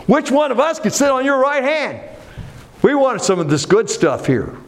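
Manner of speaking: high, pushy acted plea; eager demand. Disciples vying for the best seat.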